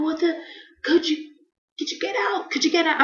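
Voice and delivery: very high, strained plea; replayed high-pitched panic; acted panicked pleading. Pleading during the painful exam.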